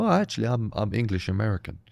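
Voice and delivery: low, soft mock American; imitated American. Comic wishing an American would confess English roots, imitated American. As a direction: lightly imitate an American, pitched well down. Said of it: "an American would come up to me and go".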